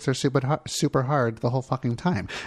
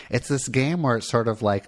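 usual speaking voice

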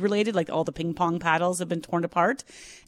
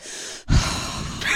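long exaggerated dog sigh; dramatic sigh. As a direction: heave a huge theatrical sigh. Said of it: "Oh, the sigh, the dramatic sigh".

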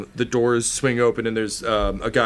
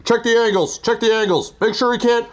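high, loud yells through a mask; stormtrooper yelling; 12 semitones higher, 4 dB louder than usual. Acted. Masked cattle farmers yelling orders at each other, much higher and louder. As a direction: bark orders like a masked trooper. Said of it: "they all sound a little bit like stormtroopers 'cause they're yelling".

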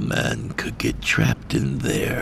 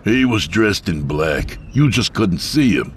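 raised, insistent voice; defensive; 6 semitones higher, 5 dB louder than usual. Acted. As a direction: push back a bit louder and higher. Flanagan defensive to girl.

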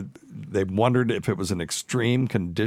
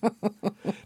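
high laugh; 13 semitones higher, 3 dB quieter than usual. Real, not acted. Laugh after mock accent.

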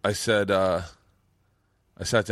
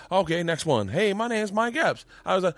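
put-on chocolate-bar kid pitch, getting higher; 7 semitones higher than usual. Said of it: "And he's like".

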